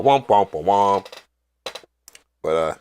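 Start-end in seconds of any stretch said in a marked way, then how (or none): 0.0-1.1 slow, deflated womp noises; mock disappointment sound effect; imitated sad trombone. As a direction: mimic a comic letdown horn, drawn out.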